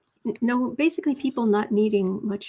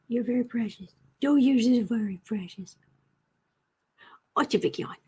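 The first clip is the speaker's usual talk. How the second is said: cooing at the cat. Real, not acted.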